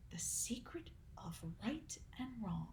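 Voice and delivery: barely voiced, awed whisper; hushed mock awe. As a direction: whisper it like a great mystery.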